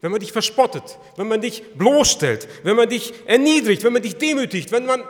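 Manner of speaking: loud, high, urgent preaching; preaching at full force. Real, not acted. Preacher pressing the congregation, loud and rising. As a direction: drive the questions home to the listeners.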